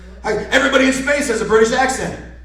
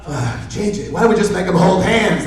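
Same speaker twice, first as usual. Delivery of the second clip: ordinary talk, nothing marked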